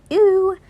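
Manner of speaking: high, loud drawn "ew"; disgusted ew. Acted. Boy finds a conch, high disgusted squeal. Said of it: "Ralph is like".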